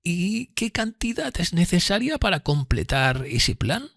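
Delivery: high, slow lady's voice, rising question; questioning Miss Havisham. Acted. Miss Havisham asking Pip about money, high and slow. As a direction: ask it slowly, pitched well up.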